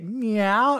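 a quick high playful meow; imitated meow. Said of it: "he's like".

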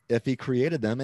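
normal talking voice

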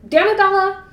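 loud, high, exasperated cry of her name; angry outburst. A narrator's reading. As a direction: burst out at her, losing control. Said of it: "he broke off, gasping".

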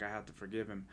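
softly, retelling a tearful moment; quiet, fighting back tears; choked up. Retold or imitated. Telling his wife he must forgive his father, choked up. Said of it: "I'm crying and I can't even talk".

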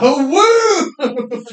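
high, loud laughing whoop; imitated whoop through laughter. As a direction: whoop while cracking up. Retelling hunter's whoop.

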